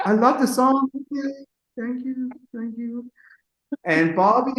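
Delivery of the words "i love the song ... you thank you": mock starstruck. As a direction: play a tongue-tied little fan. Starstruck self meeting [name].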